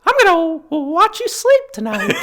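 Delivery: very high squeaky cartoon mouse; Mickey Mouse voice. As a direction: chirp it like a creepy cartoon mouse. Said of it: "just do things that Mickey would never say".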